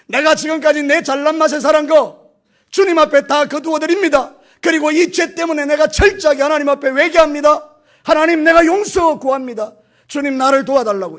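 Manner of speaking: más agudo y fuerte, predicando con fuego; predicación fervorosa. Acted. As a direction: clama como en pleno avivamiento. Predicación a plena voz.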